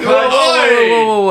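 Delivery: high loud whoa, whoa; alarmed shout. Real, not acted. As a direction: shout to stop a friend. Reacting to harsh word.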